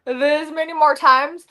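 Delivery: can't believe she has to lose again; disbelieving dismay. Retold or imitated. Tugging contestant dismayed at best two of three, high and incredulous. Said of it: "And I'm like".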